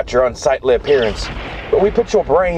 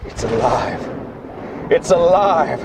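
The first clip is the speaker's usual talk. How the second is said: mad triumph; 11 semitones higher, much slower than usual. Acted. Doctor cries out as the corpse revives, building. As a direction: start hushed, end in a drawn-out shout.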